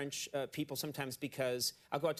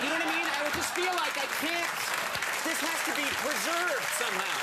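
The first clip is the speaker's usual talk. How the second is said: mock panic; 10 semitones higher, 5 dB louder than usual. Host panics about costly wine.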